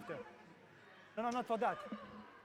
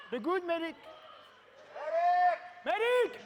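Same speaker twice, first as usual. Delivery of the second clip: loud call for the medic; man down shout; 11 semitones higher, 7 dB louder, slower than usual. Real, not acted. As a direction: shout urgently for medical help. Referee calls medic again.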